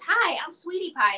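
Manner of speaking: high, loud and bouncy puppet voice; clown puppet voice. Retold or imitated. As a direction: greet the kids as a cheerful clown puppet. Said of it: "and she goes".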